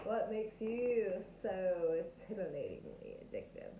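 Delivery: slow, lazy Texas drawl. Pretend. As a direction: stretch it out like a flirty Texan. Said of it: "tapping into my Texas drawl".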